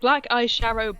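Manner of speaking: ordinary talk, nothing marked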